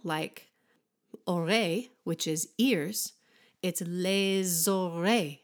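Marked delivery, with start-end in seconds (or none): 3.6-5.4 les oreilles with a linking z, lez-oreilles; French liaison. Shown as a demonstration. French teacher demonstrating the liaison in les oreilles. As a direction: join les to oreilles with a little z. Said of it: "you have a little z".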